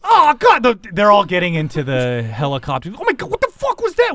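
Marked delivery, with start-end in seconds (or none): 3.0-4.1 much higher stunned question; shocked. Real, not acted. GM reacting to the stabbing in high disbelief.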